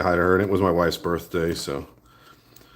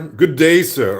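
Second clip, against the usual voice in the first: loud mock-Irish greeting, pitched up at first; hammed-up Irish accent; terrible Irish accent; 6 semitones higher, 6 dB louder, slower than usual. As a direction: call out in a badly faked Irish accent. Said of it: "my really terrible Irish accent".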